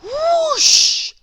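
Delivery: loud, very high sliding whoosh. A narrator's reading. Narrator voices the slide on ice, loud whoosh.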